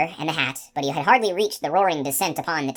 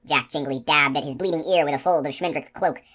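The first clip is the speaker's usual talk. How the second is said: ordinary delivery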